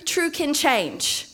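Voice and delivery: ordinary talk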